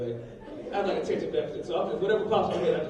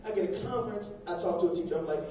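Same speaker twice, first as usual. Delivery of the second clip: usual speaking voice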